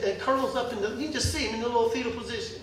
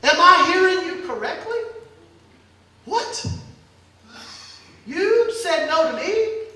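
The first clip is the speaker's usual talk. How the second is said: loud, higher, laughing in disbelief; a king who can't believe he was refused; indignant disbelief; 5 semitones higher, 5 dB louder than usual. Acted. King to his neighbor, loud, indignant disbelief.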